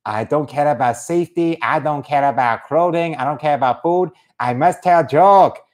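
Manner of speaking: stilted mock Korean accent; caricatured North Korean joker; North Korean comedian. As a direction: declare it as a determined Korean-accented comic. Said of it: "being a North Korean comedian".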